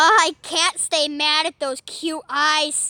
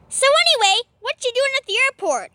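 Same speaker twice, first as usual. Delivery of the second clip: squeaky creature voice; 8 semitones higher, faster than usual. Acted. Poplio asks Baynett.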